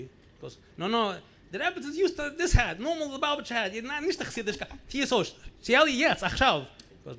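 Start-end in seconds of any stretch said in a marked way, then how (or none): 0.8-6.7 put-on higher, louder, insistent old grandmother voice. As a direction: imitate the grandmother scolding about the hat, higher and louder. Grandmother ordering grandson to change hat.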